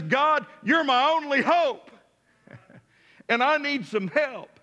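crying out. Retold or imitated. Jonah crying out to God from the fish, imitated plea. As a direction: cry out as if begging for help, acted. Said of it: "he's crying out to God".

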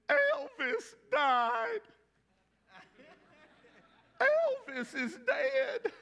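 put-on very high, slow, wailing Southern drawl of a sobbing girl; mock-tearful Southern girl wailing the news; crying southern girl. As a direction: play the heartbroken Southern teen, stretching each word high and slow. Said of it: "She had a real southern accent".

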